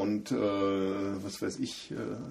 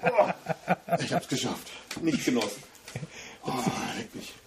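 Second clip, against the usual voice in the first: recovering after a sneeze. Real, not acted. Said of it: "Oh, das war anstrengend".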